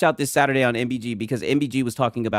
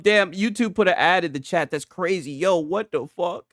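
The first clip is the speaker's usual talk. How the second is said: ordinary talk, nothing marked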